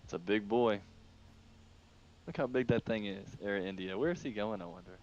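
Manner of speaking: normal talking voice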